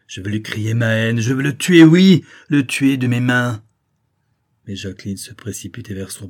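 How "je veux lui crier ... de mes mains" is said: hoarse rage. A narrator's reading. Maddened father swearing vengeance on Judex, raging cry. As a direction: erupt into murderous fury. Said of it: "s'écria d'une voix rauque, les yeux injectés de sang".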